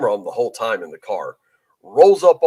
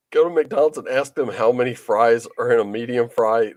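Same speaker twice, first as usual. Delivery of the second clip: ordinary talk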